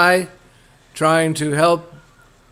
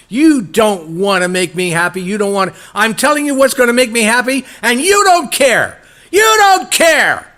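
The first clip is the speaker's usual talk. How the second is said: starts raised, climbs to a much higher, louder furious cry; an offended wife losing her temper, louder and higher with every line; building angry outburst; 7 semitones higher, 6 dB louder than usual. Acted. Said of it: "Sita then got angry and said".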